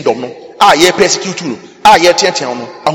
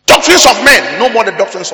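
raised, loud preaching cry; denouncing; 5 semitones higher, 4 dB louder than usual. Real, not acted. Preacher contrasting men's doctrines with God's, denouncing loudly. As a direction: cry it out in rebuke.

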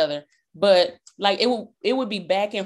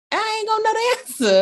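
much higher, quick put-on whine; mock exasperated voice; faster than usual. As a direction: throw it up in playful mock despair. Mock voice, imagined own reply.

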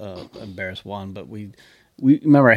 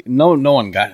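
ordinary talk, nothing marked

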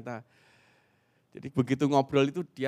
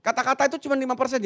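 loud, high-pitched seminar delivery; seminar penuh semangat; 12 semitones higher, 10 dB louder than usual. Real, not acted. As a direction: project it emphatically to the whole hall. (berseru lantang dengan nada tinggi)

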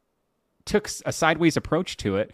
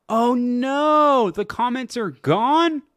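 alarmed oh no; 9 semitones higher, 4 dB louder, much slower than usual. Real, not acted. Thinks comments deleted.

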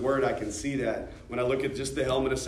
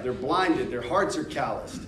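ordinary talk, nothing marked

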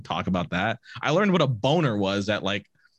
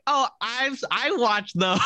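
put-on a light, higher proud kid's voice; proud bullied kid; 11 semitones higher, slower than usual. Retelling the bullied classmate raising his hand, lightly proud. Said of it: "He's like".

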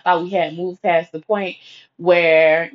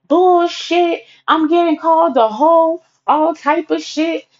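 mock outrage; 10 semitones higher, slower than usual. Friend protesting being called names, mock outraged. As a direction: throw it back high and offended.

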